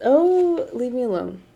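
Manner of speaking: sudden high oh. Real, not acted. Vlogger bracing against judging viewers, sudden exclamation. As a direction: jump at an imagined comment. Reacting to imagined viewer criticism.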